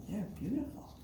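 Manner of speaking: ordinary delivery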